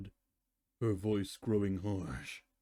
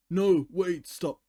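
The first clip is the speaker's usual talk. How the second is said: panicked plea; 8 semitones higher, 7 dB louder, slower than usual. Acted. A pony pleads before being banished.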